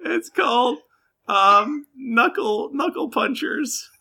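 very high, crying, fast rambling; weepy whine. Acted. Jilted girlfriend tells the adventurers about her boyfriend, weeping. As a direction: snivel out what you remember.

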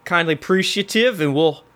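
put-on easygoing Southern constable accent. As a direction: round things off in a folksy Southern accent. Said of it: "has a southern accent saying that".

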